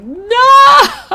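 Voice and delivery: high, loud cry of dismay; anguished shout. Retold or imitated. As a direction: yell it as your champion loses. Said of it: "And I just was like".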